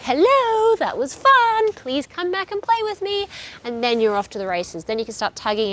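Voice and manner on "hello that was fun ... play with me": high, eager dog voice; dog pleading to play, voiced high; voicing the dog. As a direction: play the dog begging to play again. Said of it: "your dog is like".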